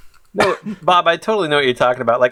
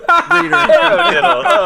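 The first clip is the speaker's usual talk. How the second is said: high slow realization; 12 semitones higher, 5 dB louder, slower than usual. Real, not acted.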